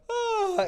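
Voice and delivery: very high yawning sound for the dog; dog's yawn imitated. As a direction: give the dog's answering yawn. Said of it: "my dog will be like".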